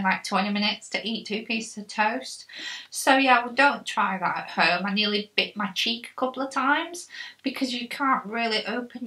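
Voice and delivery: restricted jaw opening; talking through tight strap; jaw held by chin strap. Real, not acted.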